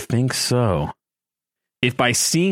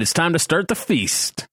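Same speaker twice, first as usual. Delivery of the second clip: gleeful; 6 semitones higher than usual. Shown as a demonstration. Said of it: "Was that gleeful?".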